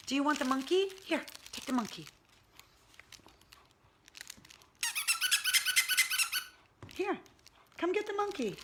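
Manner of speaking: high, sing-song coaxing of a pet. Real, not acted. Owner calling her pug to come get the monkey toy, high coaxing baby talk. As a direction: coax the sleepy pug over with a toy.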